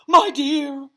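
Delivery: high, loud shriek of alarm; dramatic scream. A narrator's reading. The seer gazing up at Harry, screaming. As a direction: cry out in theatrical horror. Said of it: "Professor Trelawney screamed".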